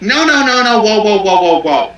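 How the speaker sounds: put-on girl protesting. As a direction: backpedal in mock alarm as the girl. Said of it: "the little girl's like".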